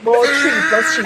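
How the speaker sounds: laughing through it; laughing hard at the maths. Real, not acted. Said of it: "laughing at the maths".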